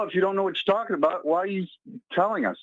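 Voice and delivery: normal talking voice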